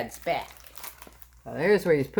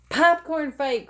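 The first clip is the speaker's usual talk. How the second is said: high, louder glee; excited cry; 9 semitones higher, 4 dB louder, slower than usual. Acted. Scene: Froggy calls out the popcorn fight in the cinema, high and gleeful. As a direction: shout it with glee. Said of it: "cried Froggy".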